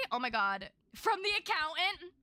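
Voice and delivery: normal talking voice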